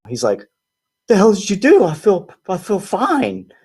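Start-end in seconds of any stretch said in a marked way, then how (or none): 1.1-3.5 high, startled disbelief; mock-astonished friend; stunned friend. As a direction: voice the friend shocked to feel fine.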